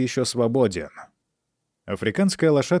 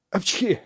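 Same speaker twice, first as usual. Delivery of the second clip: sneeze; 8 semitones higher than usual. A narrator's reading. Half-drowned boy sneezes out water.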